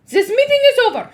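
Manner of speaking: fast, high witch shout. Acted. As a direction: bark the meeting closed. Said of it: "she shouted".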